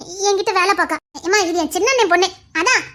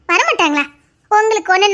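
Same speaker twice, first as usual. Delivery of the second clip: ordinary delivery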